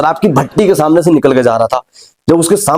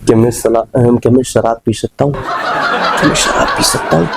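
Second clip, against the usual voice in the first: timid plea; 5 semitones lower than usual. Retold or imitated. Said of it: "बहुत ज्यादा डर जाता है".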